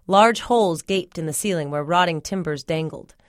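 ordinary delivery

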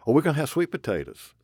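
high, fast eager reply; eager student. Retold or imitated. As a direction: chirp it out like a keen pupil. Voicing eager student answer.

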